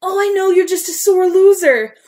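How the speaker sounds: high, loud sarcastic jab; bitter sarcasm. Acted. Upset girl snapping at James after losing, loud and high. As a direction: sneer it through hurt.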